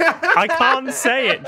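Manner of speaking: high, drawn-out words through laughter; overcome with amusement. Real, not acted. Co-host unable to repeat the reply, helpless amusement. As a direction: squeeze it out slowly, helplessly amused.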